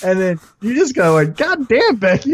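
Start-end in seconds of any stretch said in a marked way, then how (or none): 1.4-2.3 mock fan gasp. Fans reacting to attack.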